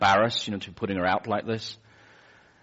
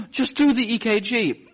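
higher, strained request; breathless plea; 13 semitones higher, 3 dB louder than usual. Retold or imitated. Breathless patient pleading with the nurse, strained. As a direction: gasp the words out. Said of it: "gasping for breath".

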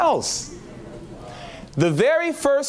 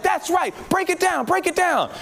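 excited cheer; 13 semitones higher than usual. Retold or imitated. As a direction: egg the preacher on from the pews. Cheering the preacher on.

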